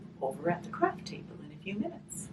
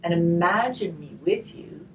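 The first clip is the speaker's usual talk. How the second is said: gentle storybook reading, slow on the rhymes; reading to children; 4 semitones higher, 7 dB louder than usual. Acted. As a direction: read the rhyme aloud to the children. Reading a rhymed picture book aloud.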